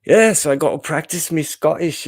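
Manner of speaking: put-on a clumsy try at a Scottish accent; bad Scottish accent. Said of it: "my accent's horrible".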